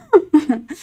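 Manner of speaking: short high burst of laughter. Real, not acted. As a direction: laugh at the punchline. Laughs at dad's retort.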